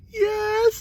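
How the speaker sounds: high, drawn-out mock wail; breaking down. Boy confessing to police, breaking down in a mock wail. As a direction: imitate a little kid cracking under questioning. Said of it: "I broke down".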